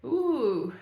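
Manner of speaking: usual speaking voice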